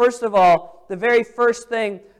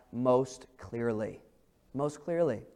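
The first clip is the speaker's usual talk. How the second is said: much lower and softer on clearly; hushed emphasis; 9 semitones lower, 11 dB quieter than usual. Acted. Preacher to congregation, hushed on clearly. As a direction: let most clearly fall to a hush.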